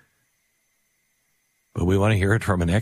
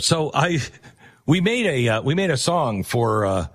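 usual speaking voice

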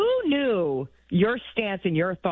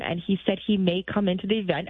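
ordinary talk, nothing marked

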